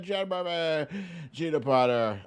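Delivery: ordinary delivery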